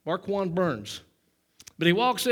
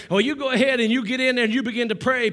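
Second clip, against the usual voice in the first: usual speaking voice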